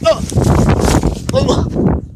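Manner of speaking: short startled cry; sharp, high exclamations; real yelp. Real, not acted. Vlogger trips jumping over a rope, real yelp. Cries out during failed jump.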